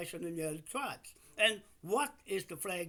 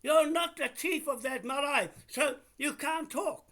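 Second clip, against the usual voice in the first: raised, higher, louder voice cutting her down; angry shouting; 6 semitones higher, 4 dB louder than usual. Real, not acted. Man shouting at the caller on the phone, furious.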